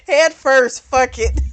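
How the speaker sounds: loud, amused burst; laughing. Real, not acted. Narrator joking about his diving in, laughing out loud. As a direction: break into a real laugh while talking.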